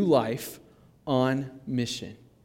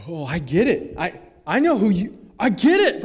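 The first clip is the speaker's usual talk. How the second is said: dawning epiphany; 3 semitones higher, 5 dB louder than usual. Acted. Mary recognizing the risen Jesus, growing amazement. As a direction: let it dawn, climbing in pitch and volume. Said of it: "the epiphany on her face".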